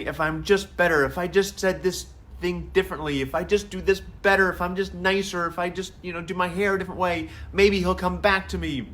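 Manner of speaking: high, pleading imitation of a hopeful dumped girl; bargaining teen. Daughter bargaining to win the boyfriend back, high mock pleading. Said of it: "The bargaining".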